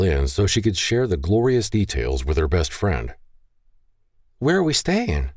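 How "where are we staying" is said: bright, eager lifted voice; high excited question. Acted. Eager bride asks about hotel.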